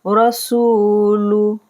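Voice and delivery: रसूलु with the सू held long and लु short; slow recitation of रसूलु; drawn-out su. Shown as a demonstration. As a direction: stretch सू, keep लु brief. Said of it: "सीन को खींचना है सू".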